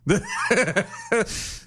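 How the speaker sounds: burst of laughter. Real, not acted. Laughing at own mix-up.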